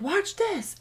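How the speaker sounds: put-on little girl showing mom her experiment, high and eager; eager child. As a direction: show off an experiment. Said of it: "she'll be like".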